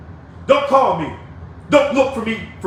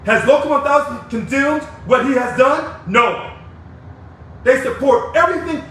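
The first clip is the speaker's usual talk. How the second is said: normal talking voice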